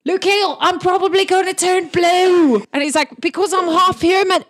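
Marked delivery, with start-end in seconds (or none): 0.1-2.6 high, loud excited shout; calling out. Acted. Calling out boastfully to Luke Hill. 3.2-4.4 high, loud blurted explanation; shouting. Acted. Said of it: "realizes that he shouted his half".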